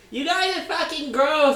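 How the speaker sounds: high, loud, slow put-on voice of a grossed-out onlooker; mock disgusted yell. Bystander yelling at the couple, mock disgusted shriek.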